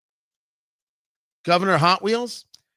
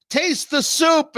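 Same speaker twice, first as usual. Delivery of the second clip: insistent; 7 semitones higher, slower than usual. Acted. Man insists again, slower.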